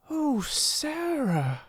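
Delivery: high, drawn-out exclamation; inspired cry. A narrator's reading. Ermengarde struck by sudden inspiration, excited cry. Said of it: "she cried".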